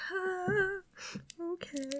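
deflated. Retold or imitated. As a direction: give up quietly. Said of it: "I'm like".

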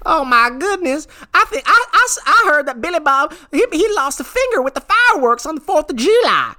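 very high, twangy hillbilly gossip voice. Acted. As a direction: cackle out gossip like a backwoods yokel. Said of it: "I'm the rodeo clown".

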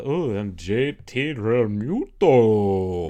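slow, drawn-out mock vampire intro. Shown as a demonstration. Demoing how a vampire says his name, slow and hammed-up. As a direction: introduce yourself like Dracula. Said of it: "the vampire voice".

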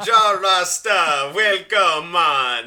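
loud, slow, put-on Jamaican greeting; drawn-out comic Jamaican patois; mock Jamaican accent. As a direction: call out in a hammed-up Jamaican accent. Guest puts on Jamaican accent as gag.

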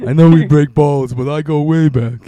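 booming gangster toast; loud mobster toast. Pretend. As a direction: raise a glass like Phil. Phil Leotardo toast, loud.

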